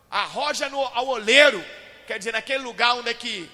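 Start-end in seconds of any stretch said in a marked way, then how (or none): 0.1-1.6 loud, high mock command; ironic. Shown as a demonstration. Preacher showing how the Lord ironizes, loud and ironic. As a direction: throw it out with scornful irony. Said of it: "sabe, com ironia".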